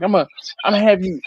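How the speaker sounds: quick retold Southern coach voice. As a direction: imitate a Southern coach rattling out orders. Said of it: "he was real Southern".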